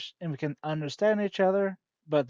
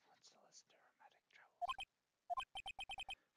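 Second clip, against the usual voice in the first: unvoiced, slow baffled whisper; hushed puzzled aside; 20 dB quieter, slower than usual. Real, not acted. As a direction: whisper it to yourself.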